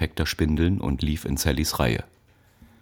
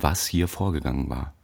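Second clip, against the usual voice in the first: ordinary delivery, nothing marked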